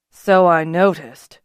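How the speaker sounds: slow, dry remark; dry understatement, taken slowly; wry. A narrator's reading. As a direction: let the irony sit in the slow words. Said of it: "Beeman said wryly".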